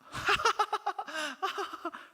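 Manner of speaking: high, nervous mock giggle breaking out; sudden high imitated laugh. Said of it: "그러면은 갑자기 웃어요".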